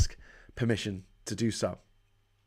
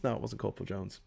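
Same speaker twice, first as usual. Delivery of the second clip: ordinary talk, nothing marked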